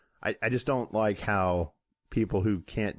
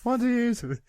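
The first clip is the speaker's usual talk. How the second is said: higher word through laughter; laughing; 10 semitones higher than usual. Real, not acted. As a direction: say it while cracking up. Laughing at onesie.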